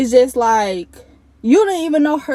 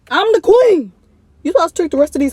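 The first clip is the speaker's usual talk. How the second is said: diva queen; 6 semitones higher, 5 dB louder than usual. Retold or imitated. Telling ex she's the queen.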